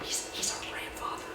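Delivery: hushed conspiratorial aside; furtive whisper. Acted. Sister lets her brothers in on the ruse, whispering. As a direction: whisper it under cover to accomplices.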